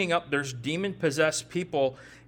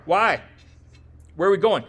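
put-on toddler asking; 6 dB louder than usual. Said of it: "any toddler parents here".

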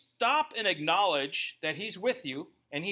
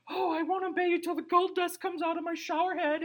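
high, hammed-up gushing believer voice; mock TV Pentecostal; 12 semitones higher than usual. Preacher mocking a TV-revival believer with a hammed-up high gush. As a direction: parody a swooning TV revival fan, way up high. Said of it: "cable T V Pentecostal type experiences".

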